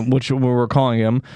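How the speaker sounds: ordinary delivery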